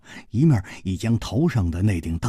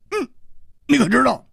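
put-on boastful old dwarf; 14 semitones higher, 3 dB louder than usual. A narrator's reading. Dwarf presenting the giant to Hu Tiehua, boastful. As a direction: quiz him proudly in a pitched-up voice.

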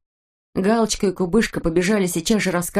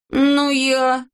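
high-pitched grudging confession; reluctant admission; 6 semitones higher, 3 dB louder than usual. A narrator's reading. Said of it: "сознался Незнайка".

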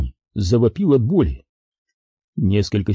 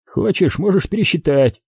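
higher-pitched character line; lightly voiced dealer; 7 semitones higher than usual. A narrator's reading. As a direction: offer it in a raised, acted voice. Деловая передача денег, реплика Дрейка. (слегка сыгранная реплика персонажа, повыше)

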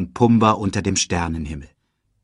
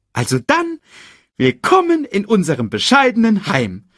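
high, quick cartoon meerkat; chirpy little sidekick voice; 10 semitones higher, 4 dB louder than usual. Timon welcomes Simba.

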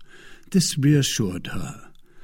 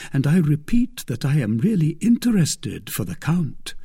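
plain reading voice

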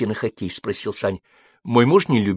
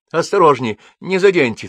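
higher, louder warning cries; shouting out; 7 semitones higher, 5 dB louder than usual. A narrator's reading. Fussy husband warning the movers about the bed, calling out. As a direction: call out to the movers to be careful. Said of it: "то и дело выкрикивал он".

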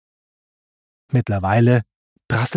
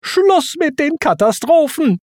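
high-pitched, fed-up outcry; exasperated shrieked order; Timon's angry yell. A narrator's reading. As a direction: put your foot down at high pitch. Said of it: "schrie Timon".

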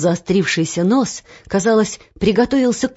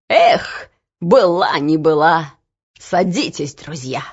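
resolved coachman; 3 semitones lower than usual. A narrator's reading. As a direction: throw caution aside, then invite them aboard. Coachman decides to help.

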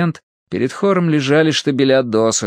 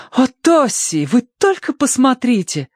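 put-on very high-pitched girlish gushing; excited exclamation; 14 semitones higher than usual. A narrator's reading. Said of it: "воскликнула она". (восторженно, очень высоким голосом)

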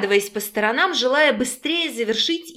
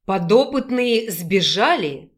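ordinary delivery, nothing marked

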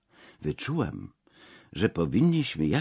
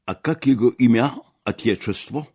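loud, higher-pitched brusque demand; raised-voice questioning in Russian; 6 semitones higher, 8 dB louder than usual. A narrator's reading. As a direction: fire the question loudly at the host. Crude guest demands name again.

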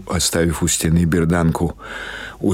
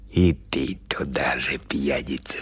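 much softer, lower, slow whisper; whispered taunt; 4 semitones lower, 7 dB quieter than usual. Acted. Baba Pasha mocking Gavrila as a drunk, in a soft whisper. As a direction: hiss a friendly jibe. Old woman mocking the watchman.

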